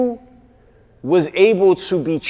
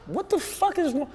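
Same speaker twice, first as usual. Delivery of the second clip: much higher, startled "yo, what's up"; confused alarm; 12 semitones higher, 6 dB quieter than usual. Retold or imitated. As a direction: reenact the baffled phone greeting, pitched way up. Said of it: "I'm like".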